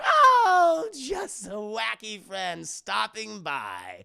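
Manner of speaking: high whooping villain, then slow drawl; playful theatrical villain; Joker. Acted. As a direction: whoop loudly and draw the words out.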